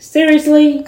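high, loud mock shock on Seriously; disbelief. Hearing the lady is sending an Uber, loud shocked disbelief. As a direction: yelp it in disbelief.

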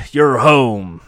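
loud, resonant Batman impression; Kevin Conroy. Bruce greeting Jason at the bedside, Batman voice. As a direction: announce it in a cartoon Batman voice. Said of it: "do the Kevin Conroy voice".

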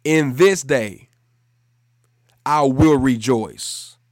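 loud, measured phrases for repeating; call-and-repeat declaration. Shown as a demonstration. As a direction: lead the congregation, pausing after each phrase. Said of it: "just say it after me".

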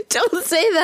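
high voice through laughter; laughing. Real, not acted. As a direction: talk while cracking up. Laughing at the song.

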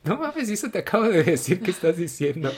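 hammed-up disbelief at the insult; high squeaky protest breaking into a laugh; offended outburst. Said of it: "Me sentí ofendido".

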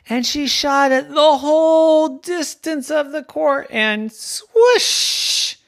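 high, loud, building narration; breathless excitement. A narrator's reading. Narrator follows the last-second winning shot, excited build. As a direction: build excitedly and stretch the final sound.

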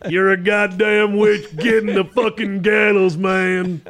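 loud, slow Southern accuser; hammed-up Southern witch-hunter yelling; Southern accent. Host doing his go-to Southern witch-hunter voice, loud. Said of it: "That's my go-to".

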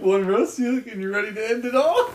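high, loud, on the edge of hysterics; laughing exaggeration. Real, not acted. Friend exaggerating the prank's effect, laughing. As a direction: push it out loudly through rising laughter. Exaggerating joke while cracking up.

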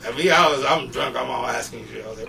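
ordinary delivery

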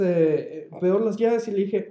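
usual speaking voice

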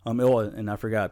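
usual speaking voice

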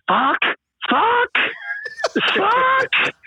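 high-pitched mock yell, one word at a time; imitated yelling. Storyteller imitating the angry drunk who knew one English word, mock yelling. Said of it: "He just kept yelling".

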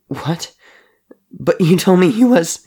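high, distressed outburst; hurt, pleading protest; tearful disbelief. A narrator's reading. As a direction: start small, then cry it out high and loud. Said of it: "tears dripping like hot wax".

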